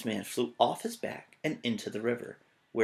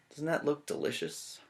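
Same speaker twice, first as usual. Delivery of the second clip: ordinary delivery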